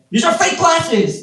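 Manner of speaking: high-pitched, drawn-out comeback; indignant mock retort. Comic countering name-calling, high mock protest. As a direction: protest in a squeal, hammed-up. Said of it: "that's how you counter".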